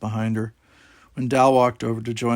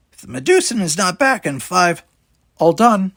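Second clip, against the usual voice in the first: higher, louder threatening alien voice; menacing alien captain; 9 semitones higher, 8 dB louder than usual. A narrator's reading. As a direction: threaten them in a put-on villain voice. Villain Talos threatens the crew.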